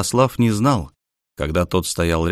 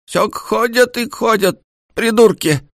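high, slow cranky granny voice; grumpy old woman; 14 semitones higher, 3 dB louder than usual. A narrator's reading. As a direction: grumble like a cross old grandmother. Grumpy grandmother grumbling.

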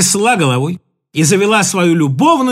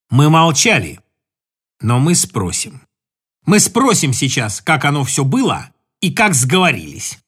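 resolute threat; 6 semitones lower than usual. Acted. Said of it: "Он решительно направился".

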